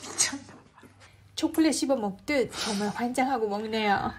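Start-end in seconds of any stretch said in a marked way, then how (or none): 0.0-0.8 whispered aside. Real, not acted. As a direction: whisper it to yourself. Muttered aside.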